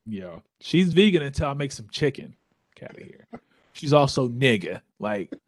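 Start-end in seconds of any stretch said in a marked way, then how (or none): 2.8-3.3 whispered aside. Real, not acted. Joking aside about the wife.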